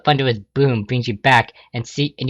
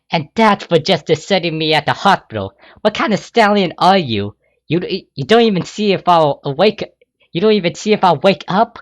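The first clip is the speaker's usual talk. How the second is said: higher, louder reproach; angry hurt scold; 7 semitones higher, 4 dB louder than usual. Acted. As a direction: blame him for leaving her.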